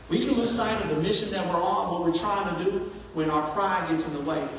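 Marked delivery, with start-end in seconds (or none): none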